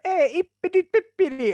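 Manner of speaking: high, loud gibberish Italian; hammed-up Italian babble; mock Italian. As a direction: exclaim nonsense in an exuberant Italian lilt. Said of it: "Italians can make anything sound amazing".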